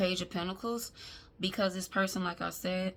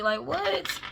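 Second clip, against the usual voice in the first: usual speaking voice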